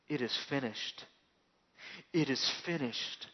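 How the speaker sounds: low, soft, spent voice; dying man's quiet last words; hushed final words. Acted. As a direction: breathe it out like a last breath. Jesus's final words on cross.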